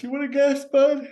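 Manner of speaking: squeaky high taunt; high mock voice. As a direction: pitch way up, teasing. Teasing reply to friend.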